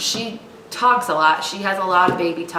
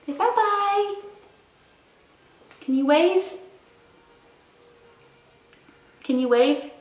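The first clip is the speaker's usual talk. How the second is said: high singsong prompting; 13 semitones higher than usual. Real, not acted. Mom prompting baby.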